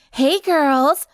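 high, cheerful greeting. A narrator's reading. Vampire greeting the girls she is chasing, cheerful. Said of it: "Lyra said cheerfully".

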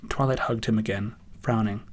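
usual speaking voice

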